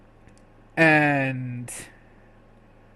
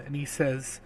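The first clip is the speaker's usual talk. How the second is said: ordinary delivery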